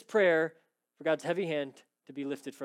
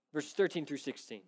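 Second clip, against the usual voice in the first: ordinary delivery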